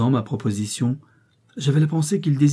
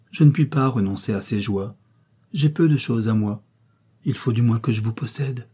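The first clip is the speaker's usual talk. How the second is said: ordinary delivery, nothing marked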